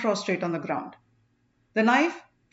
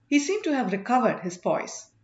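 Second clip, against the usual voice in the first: ordinary delivery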